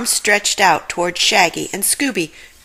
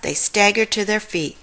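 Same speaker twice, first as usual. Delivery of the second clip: ordinary delivery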